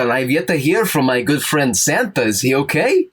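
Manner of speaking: a rabbi hearing someone call his name; kindly old rabbi. Acted.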